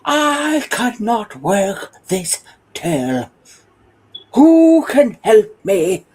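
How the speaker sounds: a lost celebrity shouting for help at the till; booming Brian Blessed. Retold or imitated. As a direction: bellow it out like the actor at full stage volume. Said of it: "I heard this voice".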